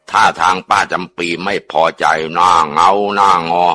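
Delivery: usual speaking voice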